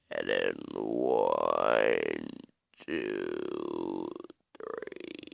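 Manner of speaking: deep, dragged-out slowed-down voice; like a slowed recording played back; Frosty slow motion voice. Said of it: "slow motion voice".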